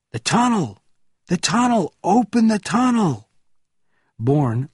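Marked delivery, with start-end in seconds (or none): 0.1-3.3 high, desperate calls; crowd's cries. A narrator's reading. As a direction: cry out urgently to be let through. Crowd cries out at closed tunnel.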